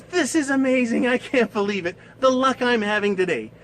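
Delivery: high, loud acted delight; thrilled. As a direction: gush like a lucky wish-winner. Woman thrilled by granted wishes.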